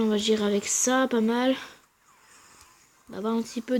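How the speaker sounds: ordinary delivery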